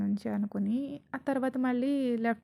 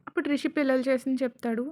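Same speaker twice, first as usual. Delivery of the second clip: ordinary delivery, nothing marked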